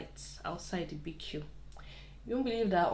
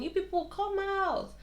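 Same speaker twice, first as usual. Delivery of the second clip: retold shouting; 12 semitones higher than usual. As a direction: imitate yelling for people to come out. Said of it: "we're shouting".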